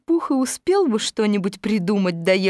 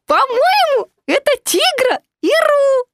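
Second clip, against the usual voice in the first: high, loud squeaky voice; excited Piglet; 6 dB louder than usual. A narrator's reading. Piglet recognises friends in the tree, shouting. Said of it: "крикнул он".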